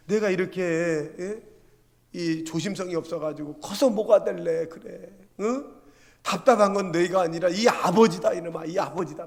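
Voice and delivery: acted loud, exasperated scolding; angry father. Father scolding his careless crying child, angry and loud. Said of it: "아버지도 막 화가 나고".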